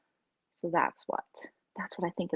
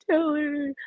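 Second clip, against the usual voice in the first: higher, called out in alarm; calling the cat; 8 semitones higher than usual. Retold or imitated. To her cat when it chokes, a higher worried call. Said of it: "I'm always like".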